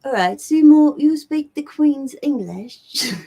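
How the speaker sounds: high, drawn-out fake British accent; mock Queen's English. Shown as a demonstration. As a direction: do a bad, hammed-up posh British. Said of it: "I know I'm doing it bad".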